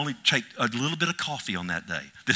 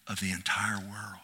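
whisper; 4 semitones lower, 5 dB quieter than usual. Acted. Whispered awe at the sin.

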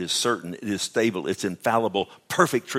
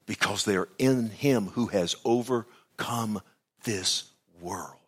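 normal talking voice

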